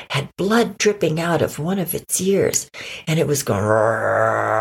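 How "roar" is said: low, sustained rolling growl; long coyote growl. Acted. Nasty coyote appears at the park, long growl. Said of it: "there was a growl".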